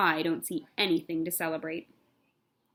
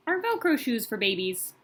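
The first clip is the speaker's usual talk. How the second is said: plain reading voice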